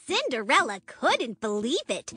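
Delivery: ordinary delivery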